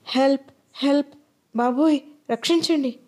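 understated scream for help; mild cry for help. A narrator's reading. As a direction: call out for rescue, held back. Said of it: "మళ్ళీ అరిచింది గట్టిగా".

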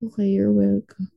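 dry, sarcastic "you're welcome". Retold or imitated. To the boyfriend who slammed the door, dry sarcasm. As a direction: mutter a mock thank-you, unimpressed. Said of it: "And I'm like".

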